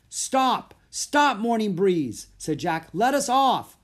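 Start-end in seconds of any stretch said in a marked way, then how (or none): 0.1-2.3 high, loud, drawn-out plea to halt; urgent calling out. A narrator's reading. Boy calls to runaway elephant, urgent shout. 2.9-3.8 high, loud demand; urgent calling out. A narrator's reading. Boy pleads with runaway elephant, urgent shout. Begging elephant to let them off.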